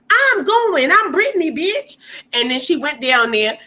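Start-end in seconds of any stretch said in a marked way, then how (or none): 0.1-2.0 bratty star barging past the guard, pitched way up. Retold or imitated. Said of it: "And he was like".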